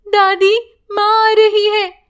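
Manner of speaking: put-on child's warning call; girl calling out. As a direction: announce mum is coming, lightly voiced girl. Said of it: "यूं कहती है".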